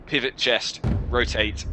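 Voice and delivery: ordinary delivery, nothing marked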